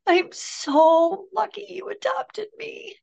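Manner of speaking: high, choked-up voice of her son crying on the phone; retold crying. Son calling mother from jail, tearful and high. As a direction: let the voice rise and tighten as if fighting tears. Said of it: "and then he starts crying".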